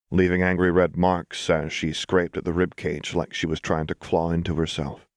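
ordinary delivery

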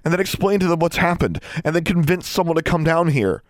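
high, agitated outpouring; urgent rush. A narrator's reading. Alexandra tells Anna why help will come too late, urgent rush. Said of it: "Her words came out in a rush".

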